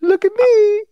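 very high owlish squeak. Pretend. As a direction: squeak it like a proud little owl. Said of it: "I'm an owl".

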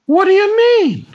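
high, loud alarmed lady; high woman voice. Acted. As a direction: cry out like a lady.

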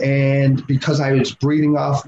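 normal talking voice

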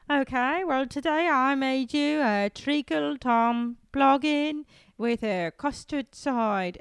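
slow, drawn-out mock British accent; British baker. British baker presenting dessert to judges, slow British accent. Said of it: "it literally is like, they're like".

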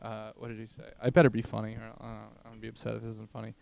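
ordinary talk, nothing marked

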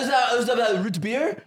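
high, loud teasing voice; mocking imitation. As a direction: ham up a clueless question. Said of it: "he's like".

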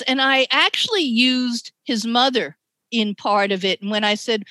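ordinary talk, nothing marked

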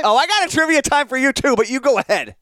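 trivia time called out high and loud like a game show host; bright game show jingle voice; excited announcement. Acted. Answers the trivia call.

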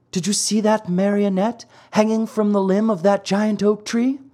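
high light fairy voice. Acted. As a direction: speak as the gentle fairy.

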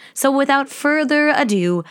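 normal talking voice

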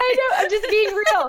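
laughing while saying it. Real, not acted. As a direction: laugh through the words. Laughing reply.

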